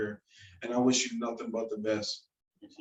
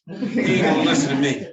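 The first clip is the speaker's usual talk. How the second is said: laughing; 8 dB louder than usual. Retold or imitated. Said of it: "He was like".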